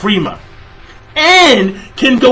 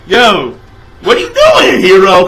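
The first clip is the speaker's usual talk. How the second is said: anguished outcry. Real, not acted. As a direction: yell at the page in dismay. Crying out at character Hero.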